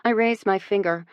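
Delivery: ordinary delivery, nothing marked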